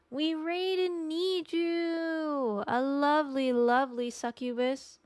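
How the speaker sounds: high, long-stretched singsong cheer; raid announcement. Real, not acted. Streamer announcing the raid to chat, excited singsong. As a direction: draw it out high like a hype announcer.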